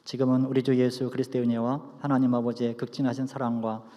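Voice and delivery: hushed, low benediction prayer. Acted.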